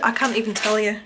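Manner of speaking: ordinary delivery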